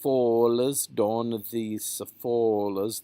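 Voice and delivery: "follows don these follows" slowly, with long o. Shown as a demonstration. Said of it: "just a long O".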